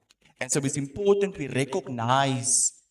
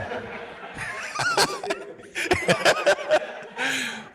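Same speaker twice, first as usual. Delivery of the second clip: laughing. Real, not acted. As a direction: burst of real laughter. Laughs at own Afrikaans aside.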